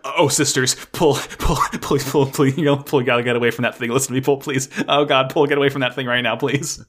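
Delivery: laughing, mock-panicked begging in a rush; afraid pleading. Fan begging the on-screen character to get away, mock-frightened. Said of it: "I was so afraid".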